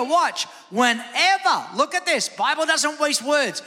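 fired-up sermon climax; preaching at full force. Acted. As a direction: rise into passionate pulpit preaching.

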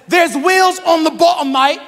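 high, loud mock Australian; Aussie accent. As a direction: shout it out as an Aussie bloke. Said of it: "he was an Aussie".

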